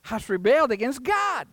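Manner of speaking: high-pitched, emphatic sermon delivery; impassioned preaching. Real, not acted. Preacher rebuking a nation turned from God, impassioned. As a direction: preach it with rising indignation.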